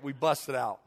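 ordinary delivery

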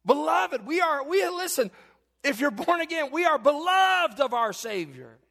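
high, loud shouted sermon climax; full-force preaching. Acted. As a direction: preach it out to the congregation with everything.

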